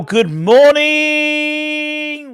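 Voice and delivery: long, high held note on "morning", loud then easing off; hollered greeting. Real, not acted. Host greets West Ham fans, hollered and drawn out. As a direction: belt out a drawn-out, high-pitched welcome.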